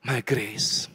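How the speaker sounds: God. Acted. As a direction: speak as a gentle divine voice, with long pauses. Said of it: "Tuhan akan katakan". (suara lembut dan rendah yang meneguhkan)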